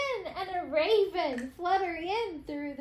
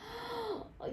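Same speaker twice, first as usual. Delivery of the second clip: sharp gasp; 6 semitones higher, 10 dB quieter than usual. Acted. Bear about to complain.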